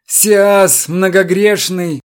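high, slow, churchy lament; self-abasing plea. A narrator's reading. Chertoslepov abasing himself before the captain, slow lament. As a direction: drag it out like a repentant sinner.

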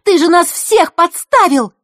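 high, loud, slow furious yell; outraged accusation; angry shout. A narrator's reading. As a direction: hammer each word in fury. Girl keeps shouting at runaway.

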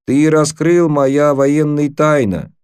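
high, slow, drawn-out protest; mock-indignant accusation. A narrator's reading. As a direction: declaim it as a stretched mock complaint. African boy's mock protest in broken Russian.

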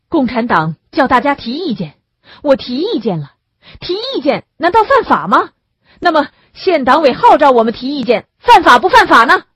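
loud, high protest, building to a shout; defiant questions climbing to full force; indignant argument. A narrator's reading. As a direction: challenge them point by point. Accused argues back against arrest.

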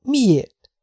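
high, eager why; excited question. A narrator's reading. Jessica asks the pilot eagerly, pitched high. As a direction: ask it breathlessly high. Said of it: "kérdezte izgatottan".

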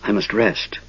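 slow, soft and drained; barely able to get the words out; exhausted mage murmuring after a spell; weak whisper. A narrator's reading. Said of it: "he whispered".

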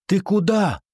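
high, surprised call; calling after. A narrator's reading. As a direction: call after a friend who is leaving. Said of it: "окликнула её Бриджит". (высокий оклик вдогонку)

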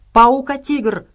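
forceful creature snarl; growled warning. A narrator's reading. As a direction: growl it out as an alarm. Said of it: "прорычал Кузу".

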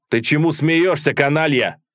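angry shout. A narrator's reading. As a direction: shout down a grinning subordinate. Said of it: "крикнул Карпов".

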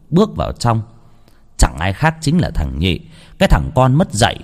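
usual speaking voice

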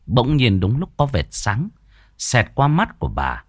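ordinary delivery, nothing marked

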